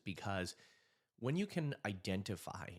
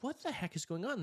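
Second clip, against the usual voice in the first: bewildered. Retold or imitated. Bewildered at intruder.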